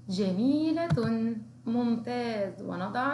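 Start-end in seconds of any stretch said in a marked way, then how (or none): 0.1-1.3 جميلة said slowly, syllable by syllable; slow dictation. Shown as a demonstration. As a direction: draw out جميلة for children to copy. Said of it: "نكرر مرة أخرى".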